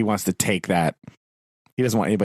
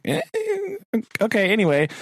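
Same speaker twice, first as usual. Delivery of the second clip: awkward brush-off; faster than usual. Acted. Awkward reaction to dated line.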